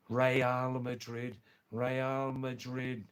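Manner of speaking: Real drawn out slowly as "ray-AHL"; correct Spanish pronunciation. Shown as a demonstration. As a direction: give "Real" two slow syllables, ray-AHL. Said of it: "It's Real".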